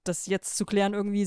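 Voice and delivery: ordinary delivery, nothing marked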